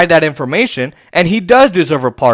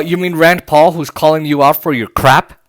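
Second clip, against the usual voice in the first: ordinary talk, nothing marked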